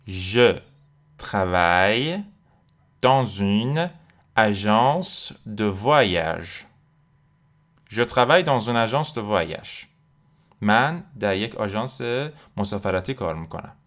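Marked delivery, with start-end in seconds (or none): 0.1-6.7 very slow, drawn-out French with pauses between chunks; stretched teacher's dictation pace; slow word-by-word model. Shown as a demonstration. Teacher breaks sentence up for learners. 7.9-9.9 whole French sentence in one connected flow; careful but continuous model sentence; joined-up sentence. Shown as a demonstration. Teacher then joins the whole sentence.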